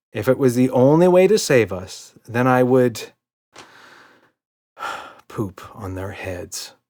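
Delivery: slow, sighing resigned admission; father reluctantly conceding. A narrator's reading. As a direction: give in with a sigh. Daddy reluctantly gives in.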